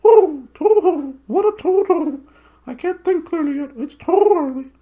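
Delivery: very high owl voice, chuckling and hooting; a flustered owl talking to itself; high hooting owl, half laughing; owl muttering to itself. Acted. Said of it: "muttering to itself".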